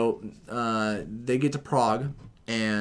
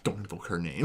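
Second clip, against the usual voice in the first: low, grave Nick Fury warning; hushed forbidding tone; ominous warning; 8 semitones lower, 7 dB quieter than usual. Retold or imitated. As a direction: lower the voice like a stern spy boss.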